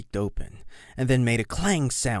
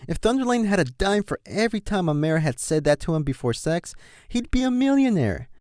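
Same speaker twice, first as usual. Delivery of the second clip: ordinary delivery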